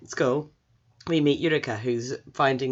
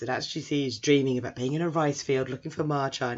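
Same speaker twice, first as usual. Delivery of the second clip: ordinary talk, nothing marked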